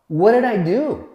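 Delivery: high, loud cry to God; desperate plea. Retold or imitated. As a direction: plead upward, voice cracking open. Desperate prayer on his knees.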